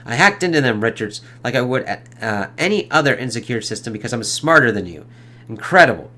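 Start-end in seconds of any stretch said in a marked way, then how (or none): none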